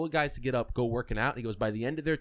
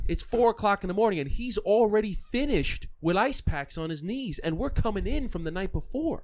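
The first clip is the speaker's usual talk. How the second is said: amazed teammate; 8 semitones higher, 3 dB louder than usual. Retold or imitated. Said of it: "one of the guys says".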